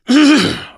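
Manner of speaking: sharp loud throat clear; quick loud cough to clear the throat. Real, not acted. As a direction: clear the throat hard, mid-sermon. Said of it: "I shouldn't be coughing".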